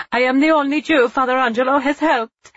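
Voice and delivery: high, loud anguished cry; cried out. Acted. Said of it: "Eva cried".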